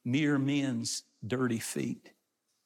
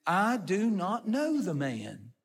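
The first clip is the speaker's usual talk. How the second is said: slow, higher-pitched sworn denial; emphatic denial; 4 semitones higher, slower than usual. Acted. Peter denying Jesus a second time, slow and insistent. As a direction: draw the denial out slowly and insistently. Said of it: "he's going deeper on the second one".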